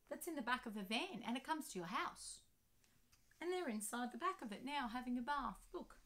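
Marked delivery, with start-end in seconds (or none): none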